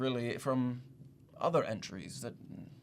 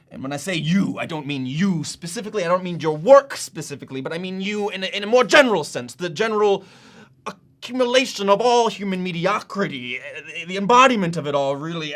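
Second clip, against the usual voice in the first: louder and louder insulting tirade; mounting indignation; 3 semitones higher, 9 dB louder than usual. Acted. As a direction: build from explanation into open contempt. Apology turns into insulting tirade.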